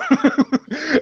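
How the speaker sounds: high burst of laughter; incredulous high laugh; amused laugh. Real, not acted. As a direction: laugh in disbelief at the absurdity. Amused at absurd news reporting.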